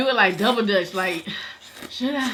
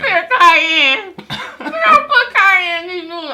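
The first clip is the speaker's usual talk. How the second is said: laughing; 8 semitones higher, 8 dB louder, slower than usual. Real, not acted. Mocking his word, laughing.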